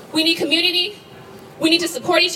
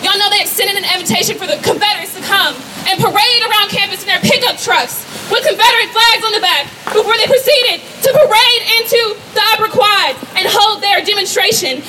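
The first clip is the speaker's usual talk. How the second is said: ordinary talk, nothing marked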